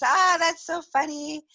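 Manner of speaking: high, drawn-out mock delight; amused reaction. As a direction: coo it like a tickled onlooker. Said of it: "it changes from, like, this is".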